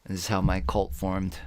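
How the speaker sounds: usual speaking voice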